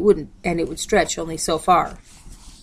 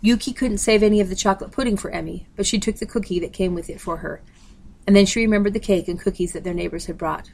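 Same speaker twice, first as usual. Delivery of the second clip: ordinary delivery, nothing marked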